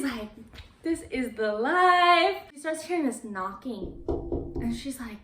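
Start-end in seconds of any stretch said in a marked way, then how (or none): 0.8-2.4 drawn-out, higher and louder mock bliss; retold blissful gloating. Girl home alone with a horror movie, blissfully savoring it. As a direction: stretch it out like someone living it up.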